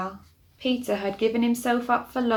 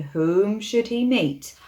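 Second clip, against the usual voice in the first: ordinary delivery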